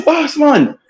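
high, loud surprised Jamaican cop; playing the officer, half laughing; police officer voice. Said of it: "They say".

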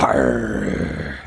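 low growled arr; monster growl. Acted. Showing off the monster accent, low growl. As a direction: growl like a beast. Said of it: "Monster accent".

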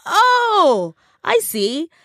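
high, loud "oh" of discovery; mock realisation. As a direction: act out a sudden penny-drop. Acted sudden realisation.

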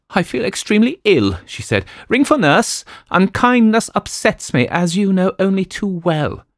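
high, loud complaining wife voice; fretful invalid wife. Acted. Wife scolding her husband George, high and loud. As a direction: whine at George like a fussy invalid.

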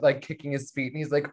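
ordinary talk

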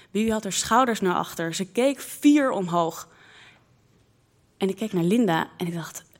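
usual speaking voice

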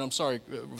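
normal talking voice

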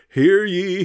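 high, loud town crier call; mock royal decree. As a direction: announce it like a herald. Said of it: "Then the states say to the locals".

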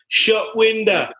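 high-pitched, slow manager catchphrase; Harry Redknapp catchphrase. Acted. Host quoting a football manager on a player, drawn-out high impression. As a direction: draw it out high, like a football manager's stock line. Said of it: "as Harry Redknapp would once say".

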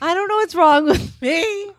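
very high mock shriek; high squeal. Shrieking at herself.

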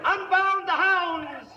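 very high, slow, loud pompous British call; hammed-up posh hunt call. Fake earl starting the hunt, shrill posh call. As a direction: announce the hunt like a ridiculous lord.

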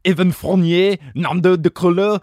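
loud, hammed-up French accent; French accent, projected like a roll call. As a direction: announce the names in a big French accent. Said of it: "read it with a French accent".

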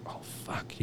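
soft unvoiced shocked curse; stunned whisper. Teller hearing his friend had cancer twice, stunned whisper. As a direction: breathe it out in disbelief.

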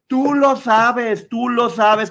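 loud, high, slow warning; emphatic scolding. Real, not acted. Telling off whoever blames hormones, emphatic scolding.

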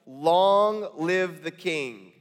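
very slow, drawn out and grave; slow solemn reading. Shown as a demonstration. Cupbearer answering the king, very slow and solemn. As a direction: lay each word down slowly before the king. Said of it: "And notice this".

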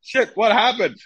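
high, loud flustered shock; panicked surprise. Retold or imitated. Goalie bewildered by halftime scolding, flustered surprise. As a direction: blurt it out alarmed. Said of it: "I was like".

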